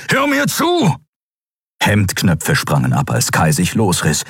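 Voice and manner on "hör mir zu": much higher, forceful demand; urgent shout. Acted. Kero holds Kai and demands he listen, urgent. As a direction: make him listen.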